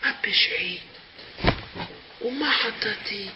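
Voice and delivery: hushed whisper. Shown as a demonstration. Yaakov answering Lavan in a calm whisper. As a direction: say it in a near whisper, no voice. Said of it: "how we're supposed to read this Pasuk".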